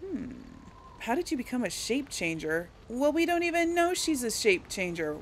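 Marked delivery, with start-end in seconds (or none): none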